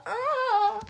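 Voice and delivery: high drawn-out "aah"; little squeal of being overcome; high wail. Real, not acted. Reader overcome as the characters hug, high wail.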